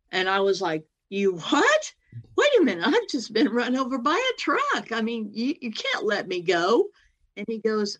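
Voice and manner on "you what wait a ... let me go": high incredulous pleading; shocked, half-laughing objection; stunned protest. Retold or imitated. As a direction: protest in disbelief, pitch leaping up. Protesting being sent home.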